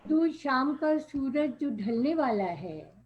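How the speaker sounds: measured verse delivery, lilting down each line; poetry recitation. Acted. Poet repeating her opening line to the audience, reciting. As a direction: recite the couplet for an appreciative gathering.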